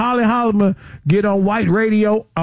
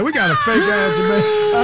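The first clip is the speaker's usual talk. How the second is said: crying with laughter, pitch jumping much higher; laughing outburst; 12 semitones higher than usual. Real, not acted. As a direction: squeal it out through laughter. Laughing, mock outrage at fake.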